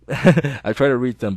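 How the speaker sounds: plain reading voice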